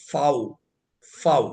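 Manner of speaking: ഫൗ said fow, with an f, not v; German letter name fau. Shown as a demonstration. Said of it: "വി എന്ന് നമ്മൾ എഴുതിയാൽ അത് വായിക്കുക".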